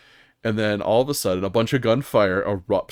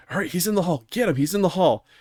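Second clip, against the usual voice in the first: retold yelling; 9 semitones higher, faster than usual. Tyler yelling at the squad in a raid, mock yell. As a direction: imitate a teammate's urgent callout. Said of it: "yelling at us".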